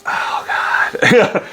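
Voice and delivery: breathy, voiceless "oh God" of dread; dismayed whisper. Retold or imitated. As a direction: whisper it in mock despair. Dismayed reaction on being told to stay.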